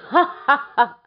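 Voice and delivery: high, loud hearty laugh; jolly laugh. A narrator's reading. Frost laughs at the old man, jolly. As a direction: laugh like a merry winter spirit.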